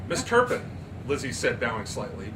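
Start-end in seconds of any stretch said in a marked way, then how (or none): 0.1-0.6 polite address. Acted. Lizzie addressing Turpin.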